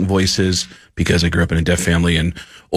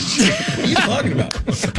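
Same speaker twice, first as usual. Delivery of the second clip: much higher, incredulous and laughing; baffled; slower than usual. Retold or imitated. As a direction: squeak out total disbelief. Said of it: "And I'm like".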